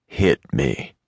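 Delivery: low, slow growl; menacing growl. A narrator's reading. Cowboy dares the lady to hit him, low menacing growl. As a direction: growl it as a dare. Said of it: "his voice a menacing growl".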